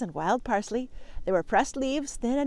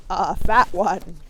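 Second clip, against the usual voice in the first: very slow, louder goat drawl; 2 semitones lower, 7 dB louder, slower than usual. Acted. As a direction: lean on each word like a goat. Mister Goat adds.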